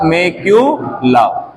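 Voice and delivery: मैक्यूलाओ said slowly, syllable by syllable; slow name demo. Shown as a demonstration. Teacher modelling a name for the class, slow. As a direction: pronounce मैक्यूलाओ slowly so the class can copy each syllable. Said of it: "बोलो मेरे साथ".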